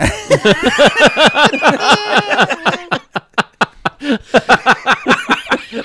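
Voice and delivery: long, loud, high burst of laughter; laughing hard at his own fanger pun, loud laughter. Real, not acted.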